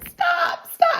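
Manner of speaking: very high mock wail; high-pitched begging to make it end; overwhelmed pleading. Retold reaction while crying at episode.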